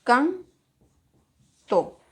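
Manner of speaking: "canto" split into can, to with a long pause; syllable by syllable. Shown as a demonstration. Teacher separates the word for pupils, "canto" syllable by syllable.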